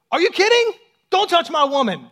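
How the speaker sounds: a comic, hammed-up royal outburst; outraged king. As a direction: blow up like a furious, jealous husband. Jealous king catches Haman grovelling.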